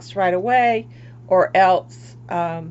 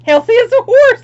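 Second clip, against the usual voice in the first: much higher and louder, laughing through it; bursting with laughter, much higher and louder; 14 dB louder than usual. Real, not acted. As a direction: sell the joke while laughing. Joking about looking healthy.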